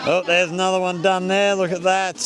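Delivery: ordinary talk, nothing marked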